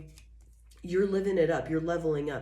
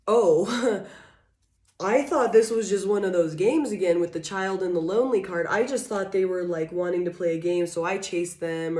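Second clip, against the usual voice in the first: louder, laughing mock realization; a mock oh-I-didn't-know excuse, loudly; mock surprised realization; 2 semitones higher, 6 dB louder than usual. The immature ones realizing it, in loud mock surprise. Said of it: "They're realizing that".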